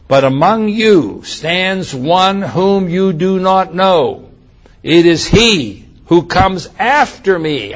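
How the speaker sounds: slow, weighted phrase; emphatic reading. Shown as a demonstration. Preacher pointing the congregation to the phrase, slow. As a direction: slowly lay out the phrase for notice. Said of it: "I want you to notice the next phrase".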